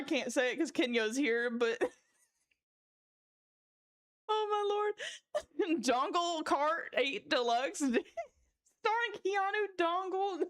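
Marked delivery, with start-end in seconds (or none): 5.0-5.8 breathless high-pitched wheeze of laughter; squeaky laugh trailing off. Real, not acted. Reacting to chat's jokes, high wheezing laugh. Streamer cracking up at chat.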